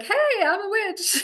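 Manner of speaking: high, drawn-out sing-out; mock cheery announcement. Healer pretending to announce herself openly in past centuries, mock cheery.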